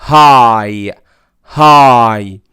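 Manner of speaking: هاي said with a long ai glide; high ending. Shown as a demonstration. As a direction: model هاي clearly, ai diphthong. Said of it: "بنطقه بصوت مختلف".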